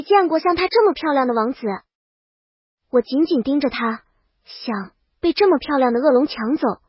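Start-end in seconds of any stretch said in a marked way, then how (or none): none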